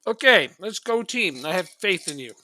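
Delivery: cheering. Real, not acted. Cheering on his team.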